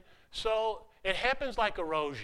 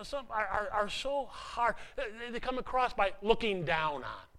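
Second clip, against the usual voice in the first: ordinary delivery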